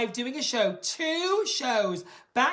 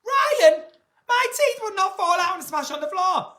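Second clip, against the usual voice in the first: loud, higher, indignant denial; indignant outburst; 10 semitones higher, 9 dB louder than usual. Real, not acted. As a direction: protest loudly to Ryan. Indignant reply to viewer.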